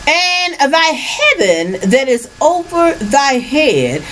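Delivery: high, slow preaching; biblical preaching. Acted. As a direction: stretch the words like a preacher. Reads scripture high and slow.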